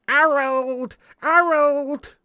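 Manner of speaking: high, loud, drawn-out call of Harold; hammed-up high holler. Calling out as sitcom figure.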